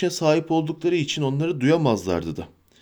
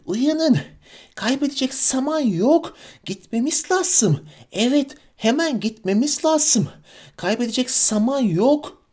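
high, wheedling creature voice; urgent, sly, high pleading; Gollum voice; 10 semitones higher than usual. Acted. Gollum urging hobbits.

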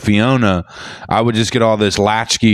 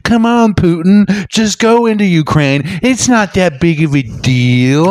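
put-on high, wheedling girlfriend voice; mock coaxing; 7 semitones higher, 3 dB louder, slower than usual. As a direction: egg him on, drawing out the last words. Plays Putin's nagging girlfriend.

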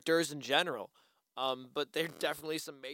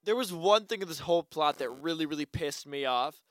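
usual speaking voice

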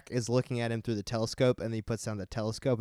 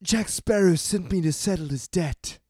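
higher-pitched young hero impression; put-on earnest movie hero, pitched up; Will Turner; 7 semitones higher, 3 dB louder than usual. Co-host feeding the cue for a movie scene, as the earnest hero. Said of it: "like Will Turner".

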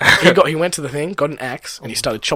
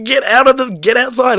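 much higher and louder, ordering them out; retelling shouting at party crashers to leave; retold shout; 13 semitones higher, 11 dB louder than usual. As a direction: bark the order to get outside.

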